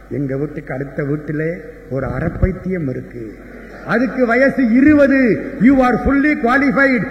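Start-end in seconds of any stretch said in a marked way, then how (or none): 3.9-5.2 put-on boy's cheeky punchline. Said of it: "sir". 5.6-7.1 slow, loud and high, hammering each word; loud punchline. Retold or imitated. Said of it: "sir".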